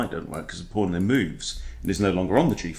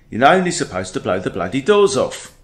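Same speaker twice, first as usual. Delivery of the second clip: seventies Michael Caine; 3 semitones higher, 5 dB louder than usual. Acted. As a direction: punch it out as a young Michael Caine. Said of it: "That's his seventies Michael Caine".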